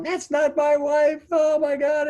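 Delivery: high, loud, slow frantic shout; panicked father. Retold or imitated. Father hearing his wife's rasping voice on the phone, panicked shout. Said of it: "he's freaking out".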